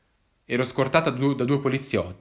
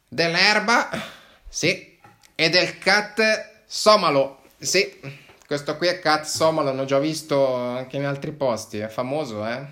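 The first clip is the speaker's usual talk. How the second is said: higher, odd-voiced agent, dragging the words; knowing agent; 4 semitones higher, 4 dB louder than usual. Agent lists the drugs found in the backpack, knowing. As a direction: savour each find like a proud searcher. Second agent searching bag.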